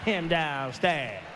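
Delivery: put-on very low, very slow toddler mumble; slow low mock toddler. Comic repeats the toddler's line to the crowd, slow low mumble. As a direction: repeat it like a kid who won't say Daddy. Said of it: "Who the fuck is him?".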